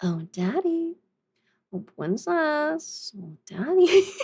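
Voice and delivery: slow, cooing pet-name voice; princess voice. Pretend. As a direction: drag out the pet names sweetly. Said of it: "in the "Oh, princess" voice".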